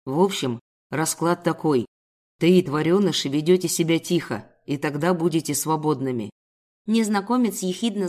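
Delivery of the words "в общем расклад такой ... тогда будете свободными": low voice of a villain; threatening captor. Acted. Kidnapper giving captive the deal, low menace. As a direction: lay down terms, low and hard.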